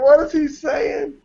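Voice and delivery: high, drawn-out retold bewilderment; baffled. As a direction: play up a dad's puzzled disbelief. Baffled dad reacting to son's accent.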